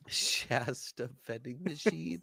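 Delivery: wheezing it out softly through laughter; laughing. Real, not acted. Co-host cracking up at friend's vending machine joke, laughing. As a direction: repeat it slowly, helpless with laughter.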